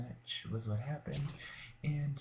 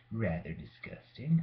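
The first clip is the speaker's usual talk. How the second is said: ordinary talk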